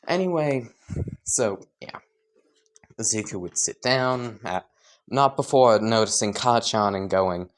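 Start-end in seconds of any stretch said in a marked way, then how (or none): none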